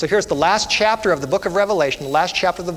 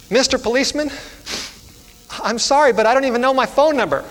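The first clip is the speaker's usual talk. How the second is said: high, upset child voice, acted; high-pitched, frustrated little girl; 8 semitones higher than usual. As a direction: play a flustered child apologizing. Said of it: "she was frustrated, and she said".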